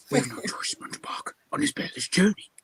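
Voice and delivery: whispered, wobbly Welsh accent attempt; a poor Welsh accent under the breath; bad Welsh accent. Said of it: "speak a bit like how he talks in Dolittle".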